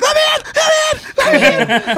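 high panicked pleading; high frantic plea at the door. Retold or imitated. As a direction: beg to be let in, high and desperate. Friend banging on the door.

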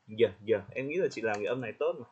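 ordinary talk

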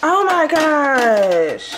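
high, slow, stretched-out dismay; drawn-out groan. Real, not acted. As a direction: drag it out in despair. Staring at the burnt bagels.

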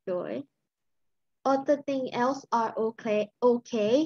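ordinary talk, nothing marked

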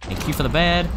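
ordinary talk

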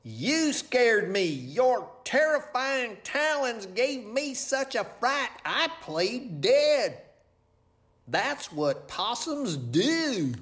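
high, slow, drawn-out complaint; stretched high possum voice; aggrieved possum. Acted. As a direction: wail the accusation high and slow.

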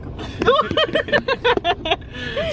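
high laughter. Real, not acted. Laughs after tickling fight.

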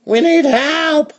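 very high, slow call; mother's high-pitched call for help. Acted. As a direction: holler it long and high. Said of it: "the wife called their daughter".

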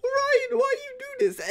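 very high falsetto whine; mock wailing squeal. Gamer asking Ryan why he killed him, squealed mock wail.

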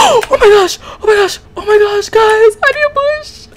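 loud, very high screaming panic; high squealing cries of terror; panicked. Real, not acted. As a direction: shriek frantically while fleeing. Fleeing the doppelgangers in game.

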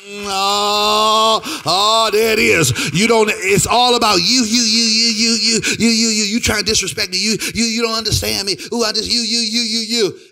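loud, hammered-out blame; acted accusing rant. Spouse blaming partner after years of marriage, loud accusing rant. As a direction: act out a heated spousal quarrel.

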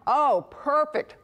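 ordinary talk, nothing marked

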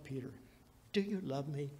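usual speaking voice

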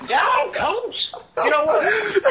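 high, loud victory cheer; excited shouting. Real, not acted. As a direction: cheer it like you just won. Celebrating the prank, shouting.

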